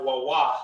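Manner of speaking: "wa wa" as wah-WAH; pronounced "wa wa". Shown as a demonstration. Teacher gives the word to repeat, sounding out "wa wa". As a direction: model "wa wa" as two open syllables. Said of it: "It's pronounced".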